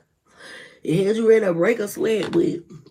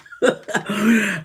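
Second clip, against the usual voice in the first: laugh. Real, not acted. Laughs after singing.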